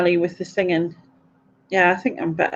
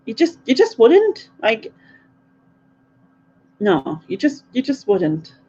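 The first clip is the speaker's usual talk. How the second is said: shocked disapproval; 4 semitones higher than usual. Retold or imitated. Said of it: "I just was like".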